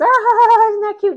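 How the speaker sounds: high, loud gushing "Ah, isn't that cute"; squealing, doting dog owner; mock cooing owner. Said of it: "And everyone goes".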